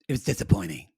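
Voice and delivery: low, soft, underplayed delivery; subdued, understated imitation. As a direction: drop it low and quiet. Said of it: "Stacy does her normal".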